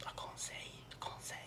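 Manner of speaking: tiny, very soft whispered reply; shy whisper. Retold or imitated. Shy girl asked her birthday wish, barely audible whisper. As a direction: whisper it like a bashful little girl.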